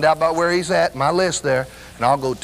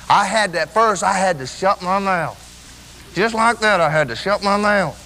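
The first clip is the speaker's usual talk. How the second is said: impassioned preaching; 8 semitones higher than usual. Acted. Preacher telling the church how hard rejoicing was, impassioned. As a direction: preach it with rising fervor, stretching the big words.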